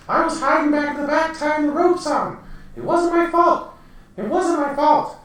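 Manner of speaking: very high, pleading little goblin voice; whiny high-pitched goblin begging his boss; panicky goblin. Acted. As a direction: squeak out excuses like a cowering goblin. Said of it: "The goblin's like".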